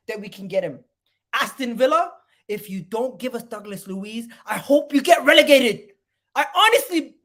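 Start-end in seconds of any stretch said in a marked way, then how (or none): 1.8-7.2 louder and higher, bitter at Villa; bitter outburst. Real, not acted. Fan cursing Aston Villa, loud and bitter.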